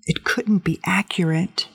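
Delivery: usual speaking voice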